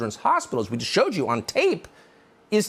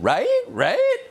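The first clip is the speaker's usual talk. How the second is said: mocking high sing-song rising Right; hammed-up uptalk; sing-songy upspeak; 4 dB louder than usual. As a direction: imitate a smug woman with upspeak, much higher and louder. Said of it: "talking in a sing-songy voice, inflection at the end".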